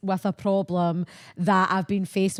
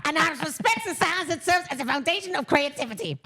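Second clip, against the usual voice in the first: higher, hammed-up rant; Douglas's onslaught; 7 semitones higher than usual. Quoting Douglas Levison's rant to the co-host, mock furious. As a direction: sneer it like a furious failed artist. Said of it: "the pinnacle of Douglas's onslaught was".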